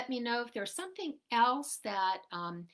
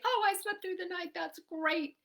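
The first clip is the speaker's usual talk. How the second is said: higher, faster happy brag; mock delight; 8 semitones higher than usual. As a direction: brag about sleeping all night. Her own proud reply quoted.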